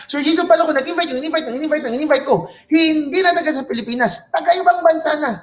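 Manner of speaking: high, fast, breathless questioner piling on; mock eager attendee. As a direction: play an excited audience member outdoing the last question. Voicing attendee, escalating question.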